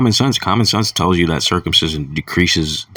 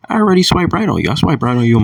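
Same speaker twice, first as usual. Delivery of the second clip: much higher, louder snappy comeback; high mock-indignant reply to the woman; indignant retort; 9 semitones higher, 3 dB louder, faster than usual. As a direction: shoot back higher and louder, pleased with yourself. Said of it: "I'm like".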